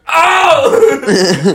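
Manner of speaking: high, loud "oh!" as if stung; exaggerated wail of shock; mock shocked cry. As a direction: yelp it out, hamming up the reaction. Acting out the stunned reaction.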